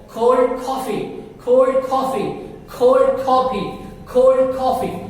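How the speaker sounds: slow, puffed K on cold; slow drill. Shown as a demonstration. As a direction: stretch out "cold coffee". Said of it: "slow drill of cold".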